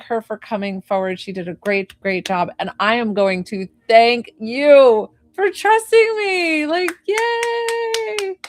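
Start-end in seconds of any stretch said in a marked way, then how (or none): 3.9-8.4 loud thanks rising into a high cheer; gleeful thanks. Real, not acted. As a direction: build to a long, high "yay". Thanking the client, celebrating.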